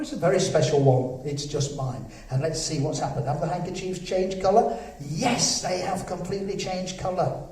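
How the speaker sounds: ordinary talk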